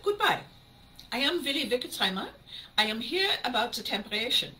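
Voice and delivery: imitated German repairman's accent. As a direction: introduce yourself formally in a German accent. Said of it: "That's the best German accent I've got".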